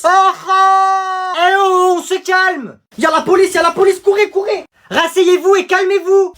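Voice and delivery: high, loud yelling across the class; panicked shouting. Acted. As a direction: scream the alarm over the chaos. Classroom chaos, police arrive.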